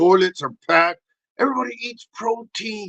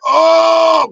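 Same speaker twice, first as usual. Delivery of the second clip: loud, much higher oh; exclaimed relief; 9 semitones higher, 11 dB louder than usual. Acted. Finishing on the toilet, loud relieved oh. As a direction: burst out in relief.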